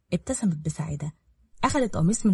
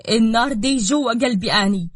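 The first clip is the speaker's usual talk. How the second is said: jealous burning vow; 3 semitones higher, 6 dB louder than usual. A narrator's reading. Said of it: "وهي بتحط إيديها على قلبها".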